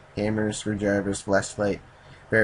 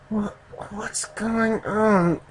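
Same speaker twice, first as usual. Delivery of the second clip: very slow, high-pitched drowsy voice; groggy waking woman; 12 semitones higher, much slower than usual. Acted. Narrator voices a woman waking up, groggy and slow. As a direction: drag the words out, high and dazed.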